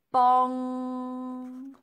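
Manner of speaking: long, loud ringing bong like a huge bell; be Big Ben striking. A narrator's reading. Big Ben chimes above Elsie, a loud held bong.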